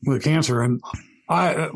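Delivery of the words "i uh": talking with a mouth full; words round a mouthful of pretzels. Real, not acted. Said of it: "I got pretzels and cheese in my mouth".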